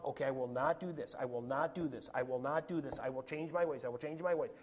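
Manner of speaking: low, quiet repetitive chant; muttered self-resolve. Acted. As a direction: mutter it to yourself like a mantra. Voices self-willed repetitive inner resolve.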